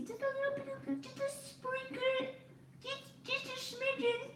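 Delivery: very high, soft little squeak; tiny squeaky voice. Pretend. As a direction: shrink the voice to a tiny smidgen. Playfully showing a tiny bit.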